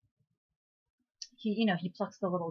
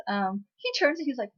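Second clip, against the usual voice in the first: ordinary talk, nothing marked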